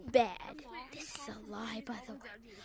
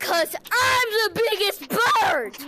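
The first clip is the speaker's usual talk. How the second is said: drawn-out shouted boast; 7 semitones higher, 5 dB louder, slower than usual. Real, not acted. Kid boasting to other players, a slow high shout. Kid yelling catchphrase in game.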